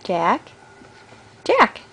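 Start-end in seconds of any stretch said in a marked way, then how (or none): 1.4-1.8 high name call; high playful call; bright call. Real, not acted. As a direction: call out to catch his attention. Calling the baby's name.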